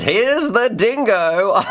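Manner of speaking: odd drawn-out voice. Real, not acted. Host unveiling the quad on the bench, oddly slow and high. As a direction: draw out "Here's the Dingo" high and slow like a showman. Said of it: "Why am I speaking like that?".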